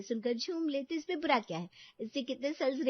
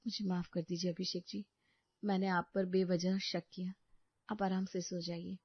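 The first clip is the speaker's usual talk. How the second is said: soft, lower sorry wife; contrite apology; 6 semitones lower, 5 dB quieter than usual. Acted. Wife softly apologizing to husband for suspecting him.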